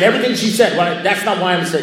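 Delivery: loud, high-pitched disbelief; exasperated protest. Retold or imitated. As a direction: protest at full tilt, re-enacting the outrage.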